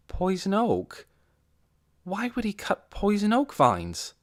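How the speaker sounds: puzzled, rising disbelief; surprised character. A narrator's reading.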